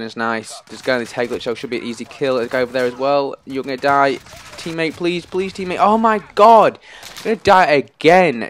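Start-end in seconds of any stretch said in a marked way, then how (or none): 4.6-5.8 high-pitched begging; urgent pleading. Real, not acted. Gamer begging a teammate for help, urgent pleading. 5.8-8.4 loud, high, drawn-out alarm; panicked cry. Real, not acted. Gamer about to die again, panicked cry.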